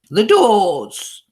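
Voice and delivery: high, loud booming witch cry; commanding Grand High Witch shout; witch's shout. A narrator's reading. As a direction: fill the room with it. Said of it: "shouted the Grand High Witch in a voice that filled the room".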